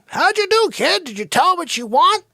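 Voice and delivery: high, loud eager dad; eager father. Retold or imitated. Father at the slide asking the kid, high and eager. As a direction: pump the kid for news.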